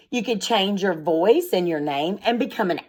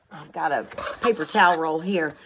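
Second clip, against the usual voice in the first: ordinary talk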